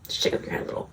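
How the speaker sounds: soft whispered instruction; whispered aside. Shown as a demonstration. Speaker whispers a direction to the listener. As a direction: murmur it quietly.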